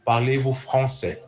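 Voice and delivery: "français" ending in "seh"; teacher's model of "français", open eh at the end; correct French. Shown as a demonstration. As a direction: correct the learner with frahn-SEH. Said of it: "Not François".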